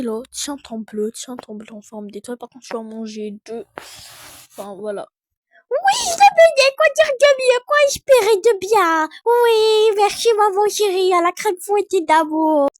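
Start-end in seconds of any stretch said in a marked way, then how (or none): 5.7-12.7 put-on high, loud child gushing thanks; cute little kid, much higher; sugary toddler voice. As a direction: lay the sweetness on thick. Toddler thanks mom sweetly.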